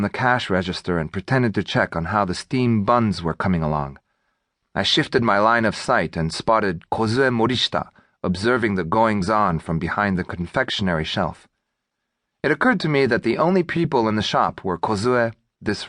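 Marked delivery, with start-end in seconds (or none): none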